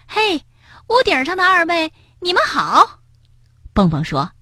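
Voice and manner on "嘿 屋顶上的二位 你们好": high, bright hailing call; calling out greeting. A narrator's reading. Rabbit greets the two storks on the roof, calling out. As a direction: hail someone up high, pitch raised.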